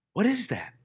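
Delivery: high-pitched puzzled question; puzzled soldier. Acted. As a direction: peer out and ask, baffled, voice rising high. Acted soldiers puzzled by horse.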